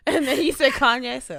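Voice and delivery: usual speaking voice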